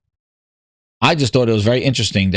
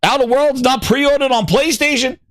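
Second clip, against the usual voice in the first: high-pitched disbelieving question; Satya, incredulous; 10 semitones higher than usual. Acted. Mimicking the CEO hearing poor pre-orders, incredulous. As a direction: react in mock shock, pitched way up. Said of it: "He's like".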